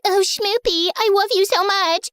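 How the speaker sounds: put-on high cooing girlfriend voice; high, sugary mock baby talk; lovey-dovey baby talk. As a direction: coo it like a smitten girlfriend. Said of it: "the girl was like".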